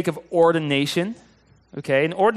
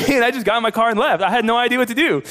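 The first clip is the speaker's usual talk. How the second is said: laughing; 8 semitones higher, 4 dB louder, faster than usual. Real, not acted. Laughing at own awkward exit.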